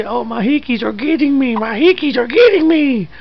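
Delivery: put-on high, whiny baby voice; voicing the baby. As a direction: complain like a baby with hiccups.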